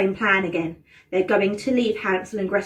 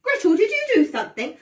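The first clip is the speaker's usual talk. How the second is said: higher, louder accusing question; suspicious Hansel; 6 semitones higher, 6 dB louder than usual. Acted. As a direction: press her, suspicious. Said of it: "Hansel accusing Gretel".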